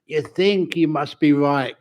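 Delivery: normal talking voice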